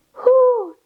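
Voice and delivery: high hooting owl call. Acted. Night noises for the kids, high owl hoot. Listing noisy night sounds.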